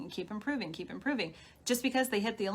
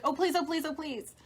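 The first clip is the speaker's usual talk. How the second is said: higher, whiny mock begging; hammed-up plea, pitched higher; mock pleading; 7 semitones higher than usual. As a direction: imitate someone begging desperately. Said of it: "Pleading's like".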